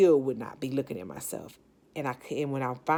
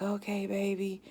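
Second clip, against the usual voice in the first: gentle to daughter; 5 semitones higher than usual. Retold or imitated. Soothing her daughter.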